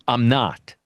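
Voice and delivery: usual speaking voice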